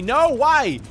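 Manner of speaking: high startled whine; alarmed, pleading; scared horror game streamer. Real, not acted. Cupcake spawned next to him.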